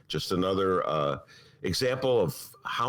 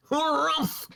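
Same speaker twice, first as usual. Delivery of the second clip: high gruff harrumph; Sam Elliott harrumph. Retold or imitated. Host imagining Sam Elliott narrating, gruff harrumph. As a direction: huff it like an old cowboy. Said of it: "I'd like to hear Sam Elliott narrate that".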